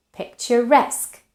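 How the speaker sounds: "picturesque" stressed on -esque; stress on last syllable. Shown as a demonstration. Teacher showing suffix stress to learners, "picturesque" stressed on -esque. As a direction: punch the -esque ending of "picturesque". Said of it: "has the stress on the suffix".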